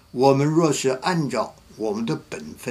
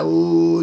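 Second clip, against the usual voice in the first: a loud higher mock sob; imitated sob; 6 semitones higher, 6 dB louder than usual. Wife breaking down in front of the pastor, mock sob. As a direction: let out a hammed-up boo-hoo. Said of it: "就哭起来了".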